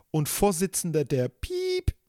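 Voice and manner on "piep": bleeping out the name; vocal censor beep. Shown as a demonstration. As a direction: say the beep slowly, higher. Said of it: "Ich hab das jetzt extra gemacht".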